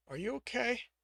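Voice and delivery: ordinary delivery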